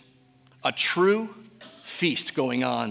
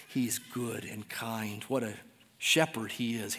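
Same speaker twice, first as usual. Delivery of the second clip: hushed tenderness; 4 semitones lower, 6 dB quieter than usual. Real, not acted. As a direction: lower the voice, speak it gently.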